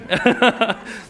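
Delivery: quick amused laugh; short laugh. Real, not acted. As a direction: laugh at your own joke. Laughs at own example.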